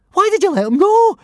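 high panicked shriek. A narrator's reading. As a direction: shriek it in terror. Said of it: "shrieked Fishlegs".

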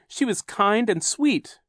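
high, drawn-out protest; indignant cry. A narrator's reading. Boy defending his aunt, high indignant cry. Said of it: "Klaus cried".

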